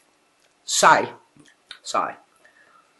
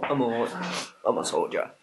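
much lower, softer tough-guy voice; gangster voice; 9 semitones lower, 9 dB quieter than usual. Pretend. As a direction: growl it like a hard man.

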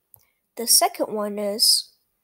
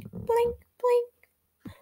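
firefly blink; 11 semitones higher than usual. Acted.

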